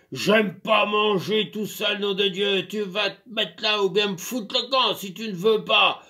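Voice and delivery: loud, high angry ranting; yelling. Acted. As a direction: bawl her out. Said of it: "il hurlait".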